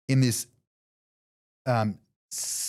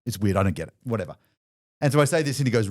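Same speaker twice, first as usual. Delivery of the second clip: usual speaking voice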